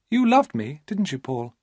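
put-on young woman charming Paul; high, wheedling female voice; Maria's coaxing voice.

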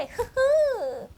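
high-pitched little giggle; cheeky high giggle; short giggle. Real, not acted. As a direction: let out a brief, high "hee-hee". Giggles after admitting Google Translate.

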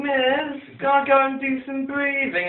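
high, pleading whine; whiny kid. Retold or imitated. Whiny kid asking the teacher for permission, high whining plea. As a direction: beg the teacher like a whiny child. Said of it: "a whiny kid in the back going".

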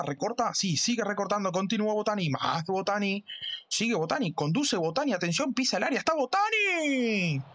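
brisk live football commentary; play-by-play commentary. Acted. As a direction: call the match like a radio commentator.

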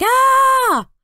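high, loud playful "Ah!" yell; mock scream. Little brother reenacts his scream at the boy for Mom. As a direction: shriek it like a scared kid.

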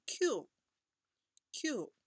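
Q drawn out very slowly as kyuu; Japanese kyuu. Shown as a demonstration. Teacher modelling nine as kyuu, very slow. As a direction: stretch Q into a long kyuu. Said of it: "一个是Q".

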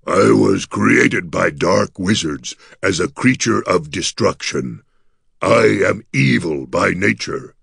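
slow, solemn creature confession; grave gargoyle. A narrator's reading. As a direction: declare it gravely in a monster's voice, taking your time. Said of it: "Gus said gravely".

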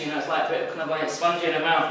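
ordinary delivery

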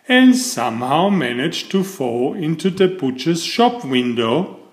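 usual speaking voice

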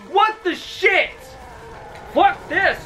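ordinary talk, nothing marked

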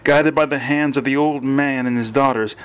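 usual speaking voice

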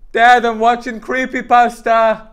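put-on high, loud child calling dad; playing a kid pestering dad; whiny kid.

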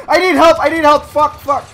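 high, loud cry for help; desperate yell. Real, not acted. Player abandoned by teammates, loud high plea. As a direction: shout for your absent team. Alone, losing the fight.

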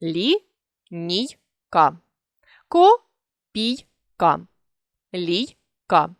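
Лі-ній-ка, Ко-пій-ка, Лій-ка spoken syllable by syllable; dictating syllable breaks; split into syllables. Shown as a demonstration. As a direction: pause after each syllable of Лінійка, Копійка, Лійка. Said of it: "ніколи не відривається буква Й".

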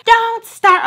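very high, loud snap; grandma snapping. Retold or imitated. Grandma snapping at her grandchild, high and loud. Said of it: "I call my grandma".

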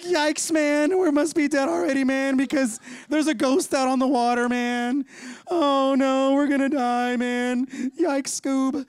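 high, loud, scared cartoon slacker voice; Shaggy. Peter seeing a ghost on the water, as a frightened cartoon Shaggy. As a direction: quaver out a goofy cowardly panic. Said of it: "kinda talking like Shaggy from Scooby-Doo".

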